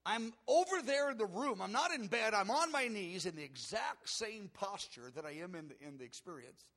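loud, high, insistent retelling; urgent preacher's exclamation; amazed outburst. Real, not acted. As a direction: build astonishment at full volume, easing at the end. Startling climax of waking.

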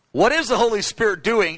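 ordinary talk

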